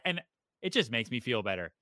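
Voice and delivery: usual speaking voice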